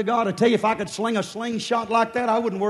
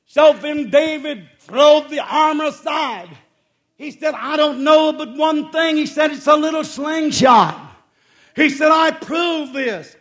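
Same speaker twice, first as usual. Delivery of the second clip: emphatic preaching; 5 semitones higher, 8 dB louder than usual. Acted. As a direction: ring out each phrase to the congregation. Preaches challenge and story emphatically.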